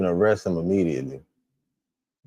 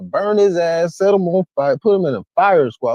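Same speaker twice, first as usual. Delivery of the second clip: higher and louder, furious demand; angry outburst; 10 semitones higher, 6 dB louder than usual. Real, not acted. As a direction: demand punishment in real anger. Angry reaction to crime.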